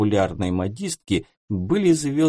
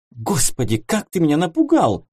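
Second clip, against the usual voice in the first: startled cry rising louder; 6 semitones higher, 3 dB louder than usual. Acted. Gesya startled by Dmitry's embrace, startled cry. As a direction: jump and gasp at the touch. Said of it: "вздрогнула та".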